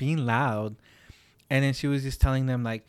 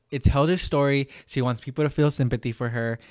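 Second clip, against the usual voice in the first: ordinary talk, nothing marked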